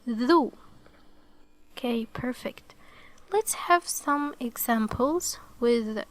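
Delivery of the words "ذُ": "ذو" said as an isolated "thoo"; voiced th into an oo vowel in "ذو". Shown as a demonstration. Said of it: "it produces the u sound".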